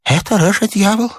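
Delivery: high-pitched screams for help; frantic, very high old man's voice; terrified cries. A narrator's reading. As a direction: cry out in mortal terror.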